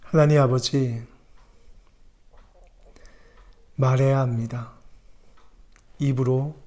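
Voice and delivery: low, soft prayer voice. Acted. Pastor prays for the congregation, soft prayer. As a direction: pray humbly and quietly.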